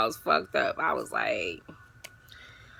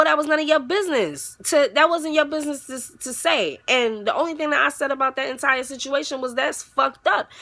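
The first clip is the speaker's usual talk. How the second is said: louder and higher, scolding; mock scolding; 8 semitones higher, 4 dB louder than usual. Scolding the girl for spilling the secret. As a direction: tell her off to her face. Telling her off.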